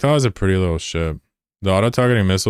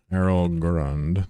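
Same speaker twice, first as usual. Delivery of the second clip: Errol Grund stretched very slowly, each syllable savored; drawn-out name; much slower than usual. Shown as a demonstration. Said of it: "Jeez, I hate that".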